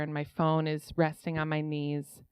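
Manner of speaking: usual speaking voice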